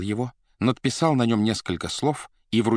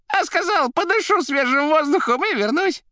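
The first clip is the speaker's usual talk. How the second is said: very high, loud chirping report; squealing telephone voice; 6 dB louder than usual. A narrator's reading. The receiver reporting what he said, squeaky and loud. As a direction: rattle it off in a squeak. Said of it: "кричала трубка".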